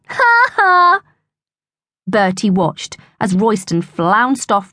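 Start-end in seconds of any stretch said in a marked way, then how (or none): 0.1-1.0 high, drawn-out "ha ha"; mocking laugh. A narrator's reading. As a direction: laugh scornfully at a rival. Bully's mocking parting laugh.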